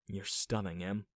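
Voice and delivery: soft, quiet whisper. Acted. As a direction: murmur it softly. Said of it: "I whispered".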